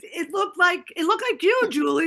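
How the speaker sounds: high, loud, animated protest; hyped-up disbelief; excited insistence. Real, not acted. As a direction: insist it, worked up. Excitedly insisting it was different.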